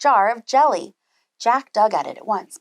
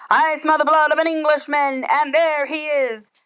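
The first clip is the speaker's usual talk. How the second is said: roaring giant; 5 semitones higher than usual. Acted. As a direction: roar it out as the chasing giant. Giant chasing Jack.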